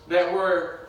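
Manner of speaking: ordinary talk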